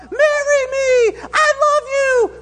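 very high, drawn-out fan scream; crowd yell. Acted. Fan yelling at a singer from the crowd, screaming. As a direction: stretch each word into a loud falsetto shout. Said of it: "someone in the crowd yell out".

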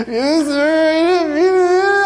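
put-on high child's voice, slow and sorry; a small boy owning up, high and timid; little boy voice. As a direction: play the little son apologizing to dad. Son Liam voiced again.

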